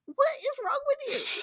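high, incredulous squeal; exasperated mock. Survivor yelling at an empty building, high exasperated mock. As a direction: imitate a baffled survivor losing patience. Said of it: "And it's like".

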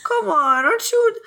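high, whiny begging, laying on the guilt; guilt-tripping plea. Acted. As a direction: pester him half in earnest, half as a guilt trip. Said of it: "half guilting and half legitimate".

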